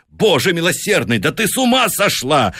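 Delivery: high, shocked cry at his wife; a husband aghast at her madness. A narrator's reading. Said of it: "вскричал он, поражённый".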